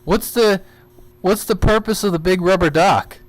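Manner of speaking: usual speaking voice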